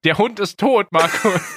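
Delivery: ordinary delivery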